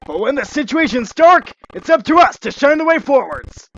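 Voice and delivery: high, loud, heroic rallying line; heroic speech. Acted. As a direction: inspire the team, bold. Sonic's heroic pep talk.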